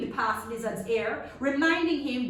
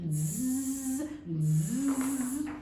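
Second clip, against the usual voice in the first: mosquito buzz. Acted.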